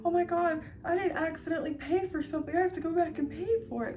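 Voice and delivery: high, hurried fretting; mock an overly honest shopper; mocking people who go back to pay; mocking goody-two-shoes. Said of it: "people that'll be like".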